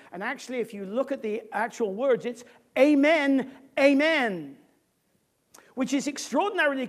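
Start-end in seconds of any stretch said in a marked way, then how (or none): 2.8-4.6 loud, weighty doubled amen; louder, each word a separate punch; emphatic declaration. Shown as a demonstration. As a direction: land each amen hard. Cites the original emphatic double word.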